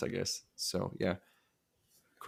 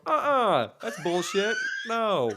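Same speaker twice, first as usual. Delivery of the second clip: hammed-up outrage at the cop; retold protest; 10 semitones higher, slower than usual. Husband retelling how he protested to the cop, mock outraged. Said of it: "And I go".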